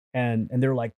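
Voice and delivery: ordinary talk, nothing marked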